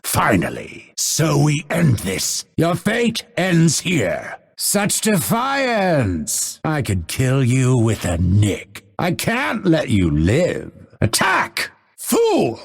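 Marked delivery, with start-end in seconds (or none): 1.0-6.6 high, drawn-out indignation; slow, high-pitched combat fury; battle threat. Acted. Finishing off a defiant foe. 11.0-11.7 very high-pitched war shout; sharp, high attack cry; shouted battle command. Acted. 12.0-12.6 very high, outraged cry; high-pitched combat outburst; angry battle insult. Acted. Insult in combat, first take.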